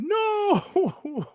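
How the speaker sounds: high, far-off mocking "No."; squeaky put-on no from PlayStation; mock PlayStation refusal. Mocking PlayStation's flat refusal, high squeaky no. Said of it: "PlayStation like".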